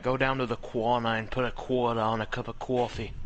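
imitating a thick Boston accent; mock Boston accent, quarter as kwahda. Friend mimicking a Boston local, lightly voiced Boston accent. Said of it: "He's like".

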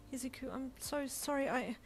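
high, halting, tearful apology; acted weepy, broken sentences; breaking down in tears. A narrator's reading. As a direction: falter as if choking back sobs. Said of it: "you broke down in tears".

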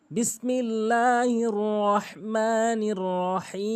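slow, drawn-out chanted tilawat; Quran recitation. Acted. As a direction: recite with long held vowels. Recites the opening formula. (ধীর লয়ে টেনে তিলাওয়াত)

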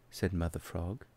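ordinary delivery

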